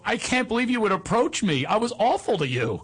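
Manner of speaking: high-pitched, retold incredulity; amazed disbelief. Former pupil confesses to his teacher, high acted disbelief.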